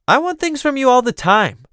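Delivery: high, indignant cousinly objection; playful protest. Acted. Theron objects that she does want things from Elaine, playful indignation.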